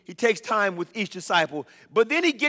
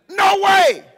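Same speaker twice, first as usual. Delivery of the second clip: emphatic refusal; 12 semitones higher, 9 dB louder than usual. Acted. Wives refusing, exclamation.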